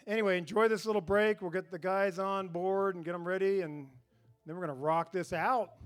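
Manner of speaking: ordinary talk, nothing marked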